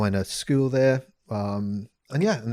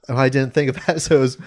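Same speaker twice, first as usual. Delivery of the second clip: usual speaking voice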